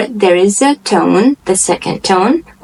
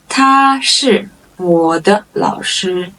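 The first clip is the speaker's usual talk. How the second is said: drawn-out words with gaps; slow model sentence; slower than usual. Shown as a demonstration. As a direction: stretch each word for learners. Model sentence, slow first take.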